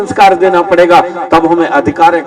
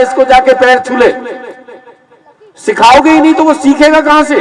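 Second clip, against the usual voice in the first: preaching louder and higher, pressing the point; raised preaching voice; 7 semitones higher, 4 dB louder than usual. Real, not acted. Preacher scolding parents who never teach, in a raised, louder voice. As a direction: drive it home to the crowd, voice lifted high.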